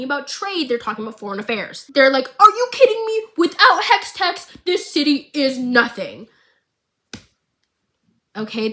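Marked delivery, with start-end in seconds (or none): 2.4-6.3 much higher and louder, then slowing on the last words; mock outrage at the idea, dragging out the end; indignant councillors shouting down the proposal; outraged protest. Councillors up in arms.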